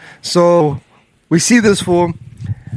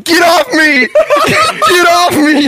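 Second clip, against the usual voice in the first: high, laughing mock yell of get off me; shrill-free high pitched mock plea, laughing through it; mock panicked yell; 11 semitones higher, slower than usual. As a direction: imitate him yelling in a high panicked voice. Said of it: "you just see Ian like this".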